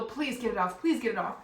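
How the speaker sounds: urgent acted begging; mock frantic, repeated plea; panicked pleading. Retells panic over bee.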